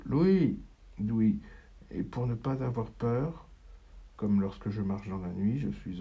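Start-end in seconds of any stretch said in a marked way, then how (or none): 0.1-1.4 anxious mother calling her son. Acted. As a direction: call his name from far away. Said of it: "Voix de la mère".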